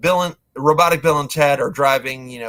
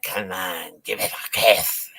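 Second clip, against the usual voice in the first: low, soft creepy grandma voice; zombie grandma; 7 semitones lower, 4 dB quieter than usual. Retold or imitated. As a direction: coax the kiss like a creepy granny. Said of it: "the grandma's like".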